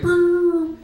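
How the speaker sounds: high, loud siren whoop; recorded cop message starting with a loud siren whoop; police siren. Pretend. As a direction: mimic a cop car siren. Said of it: "They'll be like".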